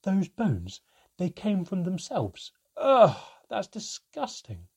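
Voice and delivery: put-on loud, high childish ugh of disgust. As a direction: recoil from the gross idea. Child learns bones regrow, disgusted.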